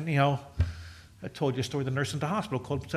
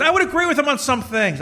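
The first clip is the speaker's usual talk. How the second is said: high, loud quote of mom; emphatic concession; 11 semitones higher, 7 dB louder than usual. Retold or imitated. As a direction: imitate a mother insisting, raised and high. Voices his mom's emphatic concession.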